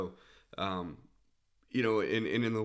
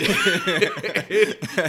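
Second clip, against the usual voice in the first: loud high burst of laughter; real laugh at the punchline; cracking up at the heckler story; big laugh; 12 semitones higher, 13 dB louder than usual. Real, not acted.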